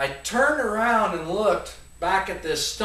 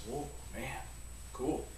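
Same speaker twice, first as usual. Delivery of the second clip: awed hush; 5 semitones lower, 16 dB quieter than usual. Retold or imitated. Kid awed by dynamite.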